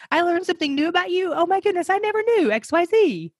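high, delighted gushing; excited wonder. Retold or imitated. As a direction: gasp it out like a thrilled discovery. Excited discovery voiced as quote.

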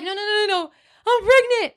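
high, rushed no no no; retold panic. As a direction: blurt it out high and frantic. Herself, panicked answer.

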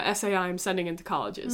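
normal talking voice

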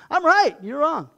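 high, loud, smug "I'm right!"; mock self-righteous shout. Preacher mocking a stubborn arguer, high loud smug shout. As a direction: play the stubborn arguer, half laughing.

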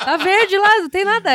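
laughing through the words; high laughing mockery. Real, not acted. Mocking deforestation denial, laughing.

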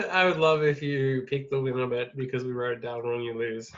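ordinary talk, nothing marked

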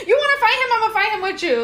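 laughing mock hype, high-pitched; laughing hype friend. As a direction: play the ride-or-die friend hyping her up, laughing. Acting out a loyal friend.

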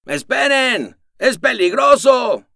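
very high, loud, urgent warning shout; alarmed shout. Acted. The ray warning the leaving squad, shouting. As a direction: yell to stop them. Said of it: "alzó la voz el rayo".

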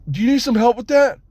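high, far-reaching call-out; retold yell. Missionary offering help from the truck, high yell. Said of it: "yell that out".

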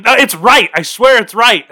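retold loud protest; insistent protest. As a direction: protest like a kid who knows he's right. Insisting to the teacher.

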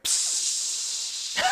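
unvoiced psst of escaping air; air hiss. Describing ride airbag, imitated air hiss.

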